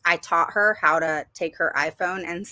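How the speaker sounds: ordinary talk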